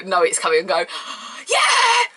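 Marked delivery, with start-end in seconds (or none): 0.8-1.4 sharp breathless gasp. Real, not acted. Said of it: "just go". 1.5-2.1 high loud yeah; cheering. Real, not acted. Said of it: "just go".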